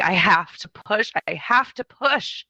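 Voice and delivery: slow, urgent retold plea; imitating a desperate labouring mother; begging. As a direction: plead insistently, stretching the words. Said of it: "looking at me and begging".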